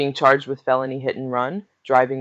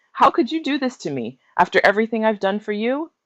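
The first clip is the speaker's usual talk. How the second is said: shrill, much higher rage at her daughter; hurt fury, voice flying up; enraged; 9 semitones higher, 4 dB louder than usual. A narrator's reading. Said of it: "She flew into a rage".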